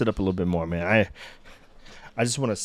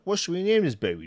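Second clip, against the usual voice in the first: much higher, squeaky put-on voice; playful high baby imitation; mock baby voice; 9 semitones higher, faster than usual. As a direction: imitate the meme's baby asking for a name. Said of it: "And he's like".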